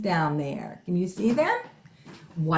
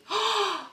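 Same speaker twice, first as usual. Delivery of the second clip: excited gasp; 12 semitones higher, 3 dB quieter than usual. Acted. Gasp before laughing verse.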